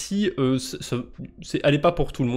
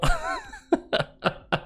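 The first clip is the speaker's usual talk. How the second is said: high laughing squeal. Real, not acted. Laughs at accidental pun.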